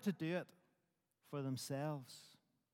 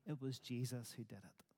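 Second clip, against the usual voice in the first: hushed whisper; 6 semitones lower, 12 dB quieter than usual. Acted. Preacher's hushed punchline.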